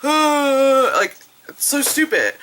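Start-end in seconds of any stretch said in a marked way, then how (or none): 0.0-0.9 mock scream. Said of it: "he's like".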